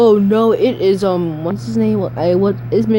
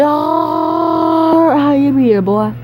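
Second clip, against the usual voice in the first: goofy slow voice; 5 semitones higher, much slower than usual. Pretend. Funny greeting voice.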